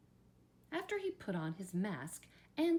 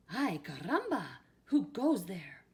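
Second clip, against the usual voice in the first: put-on Spanish accent; 6 dB louder than usual. A narrator's reading. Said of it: "asked Skippy John Jones".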